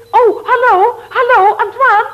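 high, loud wail of hallo; calling after. Acted. Woman calling hallo after Antoine hung up. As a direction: cry out into the dead line.

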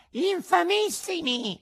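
very high, loud cat squeal; indignant cat. A narrator's reading. Cat echoes the fox's outrage, shrieking high. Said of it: "ripeté il gatto".

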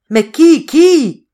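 high, very loud bewildered yell. A narrator's reading. Patrice demands who it is, yelling in bewilderment. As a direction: howl the question, rising in force. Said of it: "hurlait Patrice".

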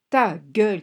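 loud, curt shout; shouting back. A narrator's reading. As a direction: yell it to shut someone up. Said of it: "cria Bob à son tour". (cri fort et sec)

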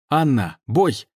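loud high call to attack; shouted command. Acted.

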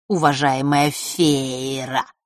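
slow, mocking drawn-out syllables. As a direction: stretch each syllable on purpose. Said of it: "произнёс намеренно, растягивая слоги".

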